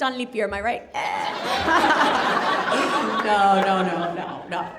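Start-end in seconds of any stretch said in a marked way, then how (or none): none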